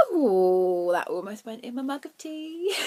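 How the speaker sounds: loud drawn-out "ooh", rising afterwards; startled excitement. Real, not acted. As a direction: yelp at a near spill, real alarm turning giddy. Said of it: "I'm sorry, I'm very excited".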